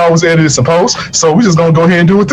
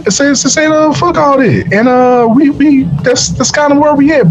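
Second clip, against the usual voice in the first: excited; 9 semitones higher than usual. Real, not acted. Animated retelling, pitch raised high.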